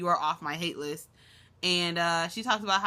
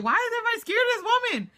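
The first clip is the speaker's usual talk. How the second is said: high, louder, fast exclamation; exasperated disbelief; 5 dB louder, faster than usual. Real, not acted. Vlogger reacting to scared Fizz, high fast disbelief. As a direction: throw it out in amused disbelief.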